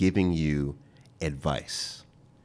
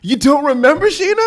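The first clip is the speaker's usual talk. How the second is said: much higher and louder, playfully indignant; mock outrage; 12 dB louder than usual. Real, not acted. Host to Sheena who forgot his advice, high mock outrage. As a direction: pretend to be wounded she forgot.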